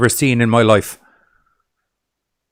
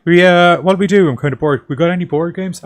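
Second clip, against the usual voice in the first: bored mock character voice; 6 semitones higher, 4 dB louder than usual. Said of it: "'cause it was just like".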